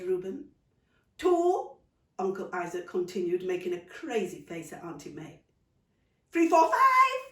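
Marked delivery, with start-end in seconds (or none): 1.2-1.8 put-on high, loud child's count. 6.3-7.2 put-on high, loud child rushing the count; rushed count. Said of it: "rushed Nico".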